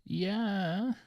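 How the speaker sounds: high, drawn-out doubtful yeah; hesitant yeah that rises in pitch; nitpick voice. Real, not acted. Co-host about to quibble with a listener's theory, nitpick voice. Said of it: "That's your nitpick voice".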